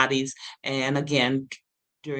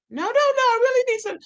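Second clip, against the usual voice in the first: rapid, much higher desperate protest; high fast begging; 3 dB louder, faster than usual. Retold or imitated. Begging teacher for water.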